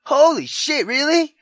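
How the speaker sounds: high loud disbelief; stunned amazement. Real, not acted. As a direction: blurt out in disbelief. Reacts to plot reveal.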